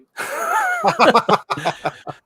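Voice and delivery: loud, high burst of laughter; real laugh at his own accent attempt; big laugh. Real, not acted. Laughing off his own South African impression, high and loud.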